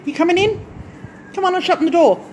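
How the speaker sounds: calling Maxie the dog in from outside, high and loud. Real, not acted. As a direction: coax the dog in with a high call.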